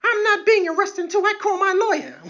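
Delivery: high, mocking arrestee voice; shrill arrestee. Comic mimics an arrestee demanding a lawyer, high mocking voice. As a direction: squeal for a lawyer like a spoiled detainee. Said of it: "there's always like".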